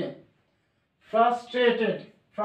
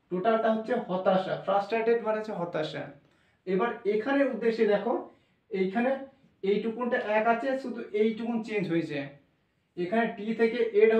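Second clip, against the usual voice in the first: ordinary talk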